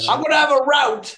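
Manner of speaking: high, loud joke with "route" as "rowt"; playful rout. Pretend. Guest playing with new Aussie slang, loud "rowt" for "route". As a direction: proclaim it, "route" rhyming with "out".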